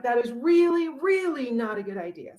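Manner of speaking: high, loud, urgent warning; mock-panicked chicks crying out a warning; little chicks at their most worked up; alarmed chicks. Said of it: "madder and madder as it goes along".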